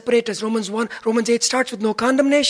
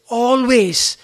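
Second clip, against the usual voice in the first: "always" drawn out and punched louder; stress on ALWAYS; 4 semitones higher, 6 dB louder than usual. Real, not acted. Preacher urging constant gratitude to the congregation, stress on "always". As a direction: lean hard on the word "always". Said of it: "I stress on that word".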